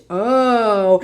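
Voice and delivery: usual speaking voice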